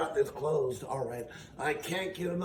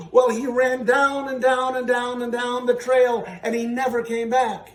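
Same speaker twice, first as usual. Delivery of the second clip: excited telling; 5 semitones higher, 6 dB louder than usual. A narrator's reading. Climax of told story.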